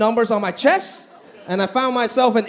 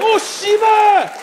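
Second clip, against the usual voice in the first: much higher, loud, very slow shout; mock Korean curse; 13 semitones higher, 5 dB louder, much slower than usual. Comic answering a fan in costume, loud slow mock curse. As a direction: yell the curse in character. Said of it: "I was like".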